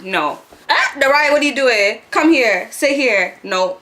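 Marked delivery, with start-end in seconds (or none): 0.7-3.4 raised, higher call across the room; the guy calling Nariah over, a bit louder and higher; calling out to her. Retold or imitated.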